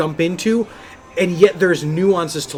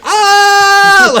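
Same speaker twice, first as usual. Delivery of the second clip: high, loud mock shriek; goblin scream; 9 dB louder than usual. Mocking the goblin announcer with a high loud shriek. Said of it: "all of us would scream".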